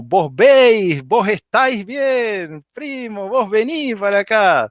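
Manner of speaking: put-on Maracaibo voseo accent. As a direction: imitate a Maracucho calling his cousin, in a lifted Maracaibo accent. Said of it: "vas a escuchar cosas como". (imitación del acento maracucho, más agudo y cantado)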